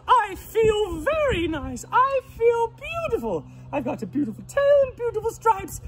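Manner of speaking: very high, loud piggy voice; delighted pig. Acted. Pig admires his new tail and stripes, high and delighted. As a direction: squeal it like a thrilled little pig.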